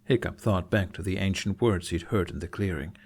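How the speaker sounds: ordinary delivery, nothing marked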